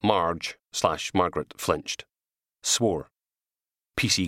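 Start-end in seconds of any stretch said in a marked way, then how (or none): none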